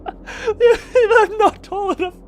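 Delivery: high squeaky laugh-cry through the words; wailing giggle at being too short; laughing squeal. Real, not acted. Streamer squealing with laughter at the tiny character.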